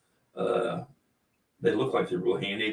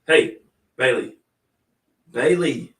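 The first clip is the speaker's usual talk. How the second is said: calling the dog; 6 dB louder, slower than usual. Real, not acted. Calling the sleeping dog over.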